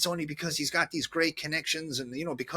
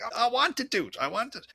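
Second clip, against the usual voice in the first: higher, louder eager grab; eager want; 10 semitones higher, 4 dB louder than usual. Retold or imitated. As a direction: insist you must have the bottle. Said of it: "I'm like".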